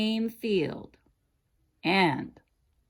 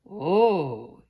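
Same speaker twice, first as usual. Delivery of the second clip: gasped; 6 dB louder than usual. A narrator's reading. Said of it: "he gasped".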